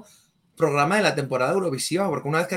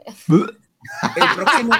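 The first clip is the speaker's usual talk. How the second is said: riendo; 7 semitones higher, 6 dB louder, much slower than usual. Real, not acted. Risa en mitad del anuncio.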